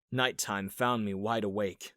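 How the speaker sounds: ordinary delivery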